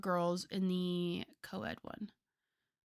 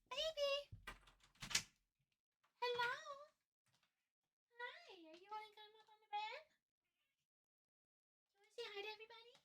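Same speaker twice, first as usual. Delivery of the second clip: cat voice; 16 dB quieter, much faster than usual. Real, not acted. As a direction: coo sweetly to your cat in a tiny high voice. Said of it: "Sorry about my cat voice".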